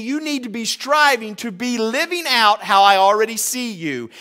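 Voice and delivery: lightly voiced God speaking to believers, high and loud; voicing God. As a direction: speak as God, earnest and firm.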